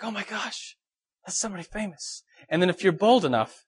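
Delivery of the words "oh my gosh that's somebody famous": hushed, higher mock-awed voice; starstruck hush. Fan spotting somebody famous at the coffee shop, starstruck hush. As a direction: act quietly amazed, keeping it very soft.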